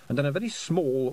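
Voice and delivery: ordinary delivery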